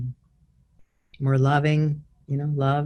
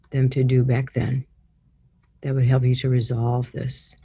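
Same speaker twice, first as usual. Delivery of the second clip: guided meditation. Acted. Leads guided healing visualization.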